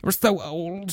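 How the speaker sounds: soft, drawn-out mock whine; hammed-up despair, slow and quiet; mock lament. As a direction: imitate a self-pitying moan, stretching the words. Mocking peers' moaning about age.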